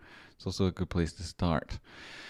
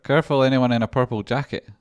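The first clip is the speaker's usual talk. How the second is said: booming jokey farewell, higher and much louder; loud playful calling out; 5 semitones higher, 10 dB louder than usual. Real, not acted. Calling after a departing friend, loud and playful. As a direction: bellow a mock warning after him.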